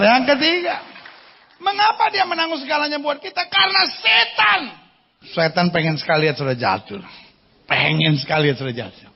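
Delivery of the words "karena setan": sudden high shout, slowed; preaching shout. Acted. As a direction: hurl the word at the crowd. Preacher's punchline. (teriakan khotbah yang lambat)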